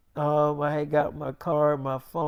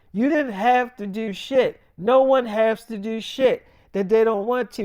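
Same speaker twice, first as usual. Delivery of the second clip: angry retort; 5 semitones higher, 5 dB louder than usual. Retold or imitated. Heated argument with girlfriend.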